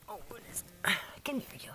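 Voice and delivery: soft whisper. Real, not acted. Owner whispering to a puppy. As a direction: hush it to the pup.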